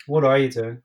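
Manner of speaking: ordinary talk, nothing marked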